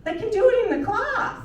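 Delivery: usual speaking voice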